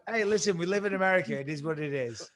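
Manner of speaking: usual speaking voice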